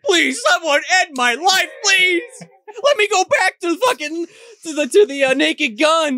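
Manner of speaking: desperate pleading. Retold or imitated. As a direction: beg to be put out of misery. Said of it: "He's like".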